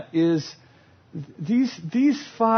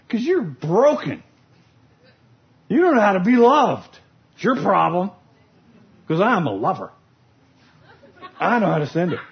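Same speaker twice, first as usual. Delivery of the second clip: frustrated blaming; 3 dB louder than usual. Retold or imitated. Frustrated lover blames partner.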